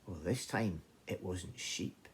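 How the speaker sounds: ordinary delivery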